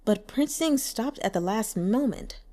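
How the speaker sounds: ordinary delivery, nothing marked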